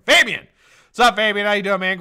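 high, loud shouted welcome; excited call-out. Real, not acted. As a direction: shout the name, then ride the energy down. Hailing an arriving viewer.

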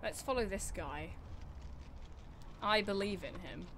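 ordinary talk, nothing marked

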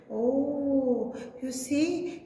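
usual speaking voice

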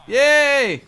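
high, loud happy yay; excited cheer. Real, not acted. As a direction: cheer with delight. Villagers make it into cart.

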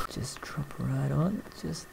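soft unvoiced whisper. Real, not acted. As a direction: hush it, barely voiced. Sneaking up on enemy.

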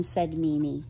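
ordinary delivery, nothing marked

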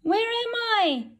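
high, small frightened question; lost baby bird. Acted. Bird set down somewhere strange, high lost chick. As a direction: ask in a lost little voice.

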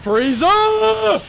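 calling Frieza, building. Real, not acted. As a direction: build from a mutter to a cry of the villain's name. Frustrated gamer calling out Frieza.

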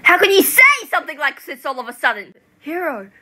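fast, high, very loud protest; yelling in furious disbelief; outraged shout. Acted. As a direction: scream it in shocked anger.

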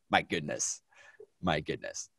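soft, low aside; hushed mock dismay. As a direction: drop low and soft, as if appalled.

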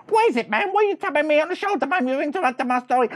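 very high, fast, loud protest; indignant squeaky rapid-fire complaint; irritated little brother. Acted. Little mouse tapped on the shoulder mid-boast, irritated.

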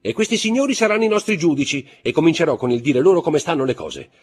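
high, loud indignant challenge; indignant defiance. A narrator's reading. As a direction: take him on in front of witnesses, voice pitched high. Baroness defies husband before the guests. (sfida indignata, a voce alta)

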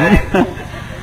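light high-pitched giggle; amused high laugh mid-story; high soft laugh. Real, not acted. Joke about mother tasting food.

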